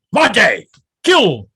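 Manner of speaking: high, loud acted order; barked command. Instructor ordering trainee to attack, barked command. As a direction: bark each word as a combat order.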